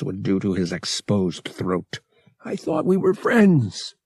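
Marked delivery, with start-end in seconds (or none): 2.5-3.9 high, pleading protest; frightened babble. A narrator's reading. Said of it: "Han babbled".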